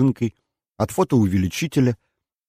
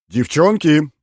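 louder, higher call across the flat; 8 semitones higher, 8 dB louder, slower than usual. A narrator's reading. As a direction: call the girls from afar. Sosnitsky calls from another room. (Сосницкий зовёт девчонок издалека)

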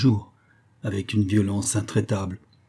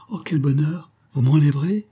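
a bright, higher cry of delight; lightly voiced girlish delight; burst of joy; 6 semitones higher, 3 dB louder than usual. A narrator's reading. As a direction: she can barely contain her joy. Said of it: "une grande secousse de joie".

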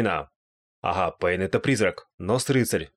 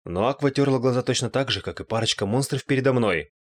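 ordinary delivery

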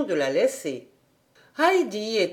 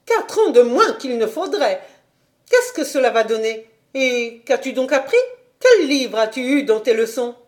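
higher and louder, indignant interrogation; outraged governess; 4 semitones higher, 6 dB louder than usual. A narrator's reading. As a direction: fire questions at the child.

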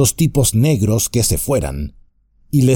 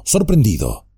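ordinary delivery, nothing marked

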